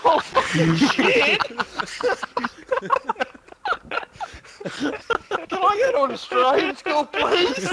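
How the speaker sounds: loud, slow, high, laughing through the words; cracking up while begging; laughing panic. Retold or imitated. Retelling his first day at school, laughing loudly.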